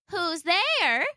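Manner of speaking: put-on high old lady calling out; kindly old grandmother. Grandmother calling out to the knock, kindly. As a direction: call through the door like a sweet old granny.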